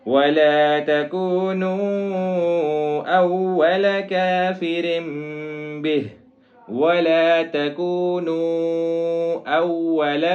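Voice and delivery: slow, melodic recitation; tajweed recitation. Shown as a demonstration. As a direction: chant drawing out the vowels. Recites verse. (تجوید کے ساتھ تلاوت)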